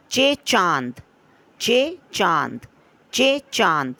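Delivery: चे stressed, then चांद falling; teacher drilling चे चांद for children; call-and-response drill. Shown as a demonstration. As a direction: repeat चे चांद with weight on चे. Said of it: "ठीक है बच्चों".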